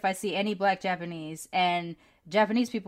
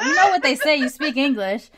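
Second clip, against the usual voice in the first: loud explaining; 6 semitones higher, 9 dB louder than usual. Retold or imitated. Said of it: "I'm like".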